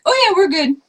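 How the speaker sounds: high, loud chirpy "oh yeah"; upbeat mock-casual reply; breezy reply. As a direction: brush him off brightly. Breezy brush-off to the clerk.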